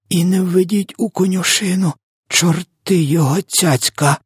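high-pitched hammed-up woe; distressed lamenting. A narrator's reading. Giant bemoaning his bad catch, high woeful lament. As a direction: bewail it, pitch way up. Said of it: "бідкався В Д В".

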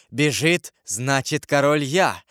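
ordinary delivery, nothing marked

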